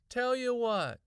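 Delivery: put-on high, loud kid's voice; casual boy. A narrator's reading. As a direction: breezily make an offer as a boy. Boy character voiced in dialogue.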